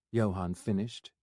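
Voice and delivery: ordinary delivery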